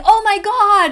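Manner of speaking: a grown man yelling at a cat; mock yell. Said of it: "Somebody starts yelling".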